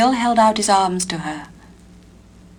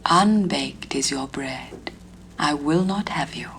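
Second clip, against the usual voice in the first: slow fairy whisper; soft voice; 4 semitones lower, 6 dB quieter than usual. A narrator's reading. Fairy lady turning down the unbaked bread, soft whisper. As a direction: whisper the refusal gently and slowly. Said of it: "said in her soft voice".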